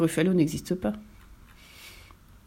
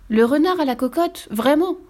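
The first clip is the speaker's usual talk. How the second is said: higher, louder scared fox; panicked hurry; 8 semitones higher, 3 dB louder than usual. A narrator's reading. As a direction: blurt it out and flee. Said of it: "dit le renard en hâte".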